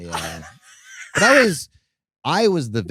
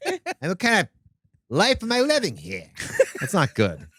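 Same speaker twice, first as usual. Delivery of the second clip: pitched up high, whiny mock version of her; a hammed-up, higher caricature of a friend; impression of Jordan; 10 semitones higher than usual. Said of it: "You don't sound like that at all".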